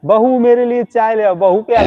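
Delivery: high mother-in-law calling for tea. Retold or imitated. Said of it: "सास को क्या देती होगी तू". (ऊँची आवाज़ में सास की नकल)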